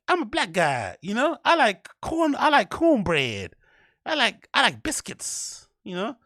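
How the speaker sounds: high slow cartoonish parody. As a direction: stretch it out as a parody. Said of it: "that kind of voice inflection".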